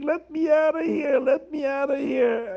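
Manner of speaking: high, loud cry to be let out; locked-up man screaming from the basement. Acted. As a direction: pound the door and scream to be freed. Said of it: "he started screaming".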